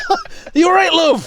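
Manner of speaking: hammed-up yell across a distance; mock shout from afar. Joking about people calling to the fallen granny from afar. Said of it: "shouting to her from across the road".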